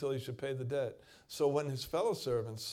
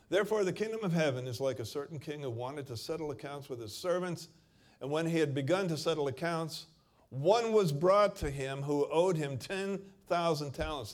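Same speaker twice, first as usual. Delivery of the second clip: louder, forceful Bible reading; preaching scripture; 4 semitones higher, 7 dB louder than usual. Acted. Preacher reads the parable aloud to the church, forceful. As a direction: proclaim the passage to the congregation.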